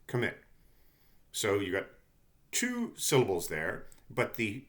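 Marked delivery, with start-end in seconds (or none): 0.1-0.4 commit, with the weight on mit; stress on second syllable. Shown as a demonstration. Teacher showing students the stress in commit. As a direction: lean on the second beat of commit. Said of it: "think of the way I just pronounced it".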